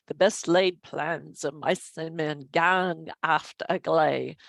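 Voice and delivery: slow, hammed-up Scottish accent. Lecturer quoting Robert Burns to her class, mock Scottish accent. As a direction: recite the Burns line in a jokey Scottish accent. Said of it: "That's my great Scottish, uh, accent".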